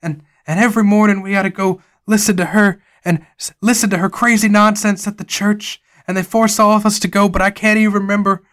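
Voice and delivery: high, loud frantic warning; frantic Jonas. Acted. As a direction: urge them in a rising panic. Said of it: "Jonas says".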